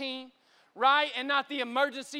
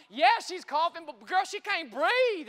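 higher, louder exasperated alarm; freaking out; 4 semitones higher, 4 dB louder than usual. Retold or imitated. Father telling the operator his baby can't breathe, acted freak-out. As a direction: insist in a retold panic. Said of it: "I'm freaking out".

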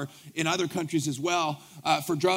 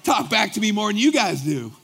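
louder, higher pleased exclaim; delighted surprise; 4 semitones higher, 6 dB louder than usual. Retold or imitated. Pastor thrilled at the prisoners' loud response. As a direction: ride the crowd's energy.

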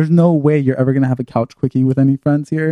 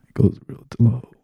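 sultry low voice; 4 dB quieter than usual. Shown as a demonstration. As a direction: drop into a sex podcast voice. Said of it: "What's your sex podcast voice?".

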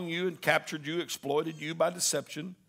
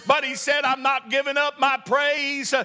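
high, loud preacher's climb, building fervor; roaring revival preaching; full-force preaching; 8 semitones higher, 7 dB louder than usual. Acted. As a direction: preach it to the rafters.

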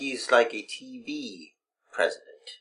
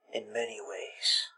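whisper; 3 semitones lower, 13 dB quieter, faster than usual. Acted. Host's smug aside.

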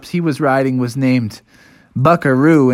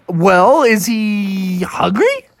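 higher, drawn-out hesitation; wary question; 7 semitones higher, much slower than usual. Acted. Bears asking if the dinosaur eats bears, wary. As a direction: hesitate before the worry. Bears worry about being eaten.

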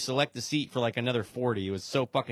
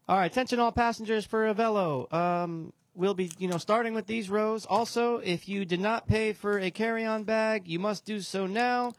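gate agent on the PA, higher; airport announcement; 7 semitones higher than usual. Retold or imitated. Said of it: "the lady comes on the announcement".

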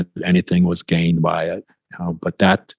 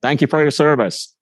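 lightly imitating a stranger, pitched up; retold brisk thanks in a higher voice; gruff stranger; 7 semitones higher than usual. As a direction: toss off the stranger's thanks, voice raised. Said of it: "He said".

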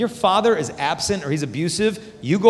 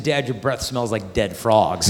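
daughter voice; 7 semitones lower, slower than usual. Retold or imitated. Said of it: "She said".

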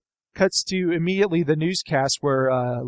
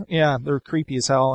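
ordinary talk

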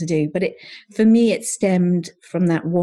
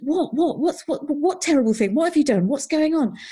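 much higher, startled rapid questions; alarmed husband; 9 semitones higher than usual. Retold or imitated. Husband fearing the worst on the phone, alarmed and high. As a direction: fire off worried whats, bracing for bad news. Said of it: "he was like".

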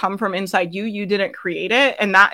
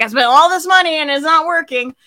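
higher, louder whining moan; a put-upon whine about money spent for nothing; whiny complaint; 7 semitones higher, 5 dB louder than usual. Retold or imitated. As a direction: whine it like a discouraged business owner. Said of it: "kinda whiny and kinda slow".

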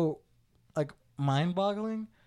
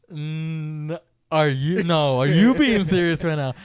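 loud, slow question thrown back; incredulous comeback; 8 dB louder, much slower than usual. Retold or imitated. Brother turning his sister's question back on her, incredulous. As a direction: push it back at her with stress on YOU. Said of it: "And I was like".

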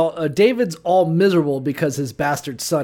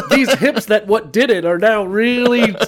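higher mock-pained complaint; hammed-up ailing man, pushed up in pitch; Harvey Weinstein; 4 semitones higher, 4 dB louder than usual. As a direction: moan about aching hips for laughs. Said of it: "like how Harvey Weinstein's walking around with a walker".

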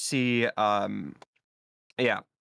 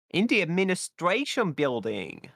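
terrifying silly voice; 4 semitones higher than usual. Pretend. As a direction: draw it out with exaggerated swoops in pitch. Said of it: "That was terrifying".

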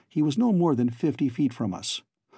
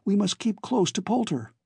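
urgent Alan; 8 semitones higher than usual. A narrator's reading. Alan insists on following Poulter.

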